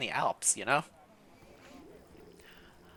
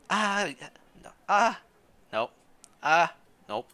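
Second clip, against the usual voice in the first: put-on old lady voice; 4 semitones higher than usual. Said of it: "an old lady voice".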